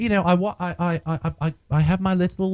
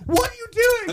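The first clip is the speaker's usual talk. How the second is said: squeaky mock panic, much higher; panicked squeal. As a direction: squeal it like a scared victim.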